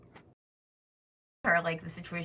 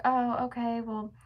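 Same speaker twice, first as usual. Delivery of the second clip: higher-pitched put-on customer service voice; mock phone rep; 6 semitones higher than usual. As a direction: imitate the rep in a high sing-out tone. Retells phone rep's brush-off.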